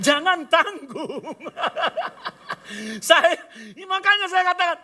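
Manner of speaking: loud, high, speaking through laughter; delighted laugh. Real, not acted. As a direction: laugh the words out. Laughing after the boss's offer.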